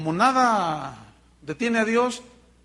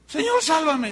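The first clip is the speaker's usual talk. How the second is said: cry for help; 8 semitones higher, 4 dB louder than usual. A narrator's reading. Pedro sinking cries for help.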